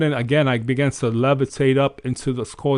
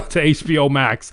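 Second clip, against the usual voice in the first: normal talking voice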